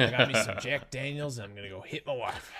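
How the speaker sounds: hammed-up Southern good ol' boy; Southern accent. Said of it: "that's pretty Southern".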